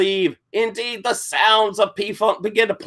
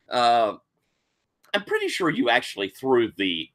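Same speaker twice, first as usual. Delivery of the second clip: ordinary talk, nothing marked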